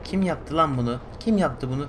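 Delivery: indignant outcry. Real, not acted. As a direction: demand who did it, outraged. Player outraged teddy taken.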